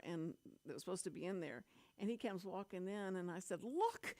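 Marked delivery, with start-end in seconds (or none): 3.6-4.1 high-pitched urgent call; retold alarm, pitched well up; urgent alert. Retells alerting coworker to wrong channel.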